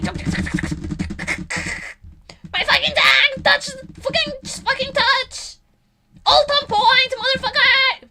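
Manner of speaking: high, loud rage at a teammate; acted full-force gamer meltdown; frustrated screaming. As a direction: scream it like a sweaty gamer losing in overtime. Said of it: "Screaming to myself".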